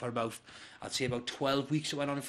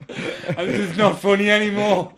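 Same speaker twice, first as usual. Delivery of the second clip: loud, higher, hammed-up wail; retold tearful breakdown played for laughs; mock crying; 6 semitones higher, 12 dB louder than usual. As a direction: imitate blubbing mid-joke, half laughing.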